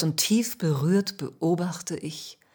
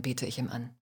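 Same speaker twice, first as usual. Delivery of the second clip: ordinary delivery, nothing marked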